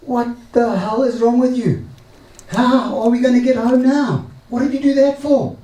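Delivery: high, heated mock outburst at the husband; wife yelling at her husband over the dead donkey, high and heated; angry shouting wife. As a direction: play the furious wife, pitched well up. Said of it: "she starts shouting at him".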